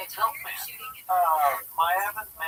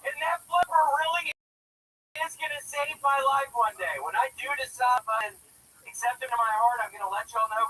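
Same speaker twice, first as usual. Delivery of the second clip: fervent excitement; 7 semitones higher than usual. Acted. Mock fervent testimony after the shouting.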